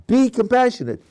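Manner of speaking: be compassionate said as a firm command. Shown as a demonstration. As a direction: give it as an order. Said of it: "That's a command".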